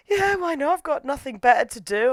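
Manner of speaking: mock careless acceptance. Brokenhearted Shizuka accepting her invitation, mock resigned. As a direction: agree with hammed-up resignation.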